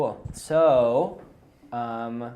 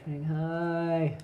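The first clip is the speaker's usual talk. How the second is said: ordinary talk, nothing marked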